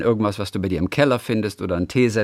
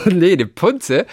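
laughing; 6 semitones higher, 5 dB louder, much slower than usual. Real, not acted. Das ist weibliche Geschlechtsteil?.

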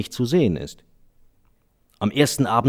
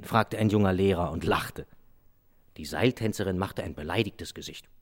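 plain reading voice

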